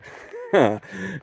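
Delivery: short high-pitched laugh; brief amused laugh at your own story. Real, not acted. Laughs after nothing was there.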